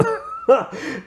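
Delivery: sudden high-pitched giggle; high squealing laugh. Real, not acted. Laughing at Keaton hamming it up.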